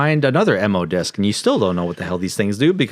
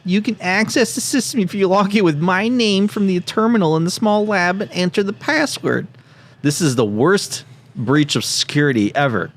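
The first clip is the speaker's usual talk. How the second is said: nerdy scientist voice; 10 semitones higher than usual. Acted. Keeps reading letter in voice.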